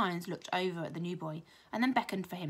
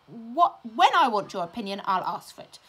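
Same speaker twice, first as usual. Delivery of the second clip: loud furious teacher; shouting; 3 semitones higher, 9 dB louder than usual. A narrator's reading. Strict teacher shouting at a pupil who interrupted. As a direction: bellow down at the cheeky child. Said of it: "shouted Mister Irons".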